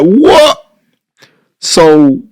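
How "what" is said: high, loud stunned "What?"; reliving the shock of getting paid. Retold or imitated. Shocked at cash returned.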